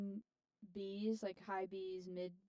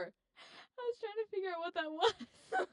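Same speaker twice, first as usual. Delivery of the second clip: laughing; 10 semitones higher, 5 dB louder than usual. Real, not acted. Laughing at her friend.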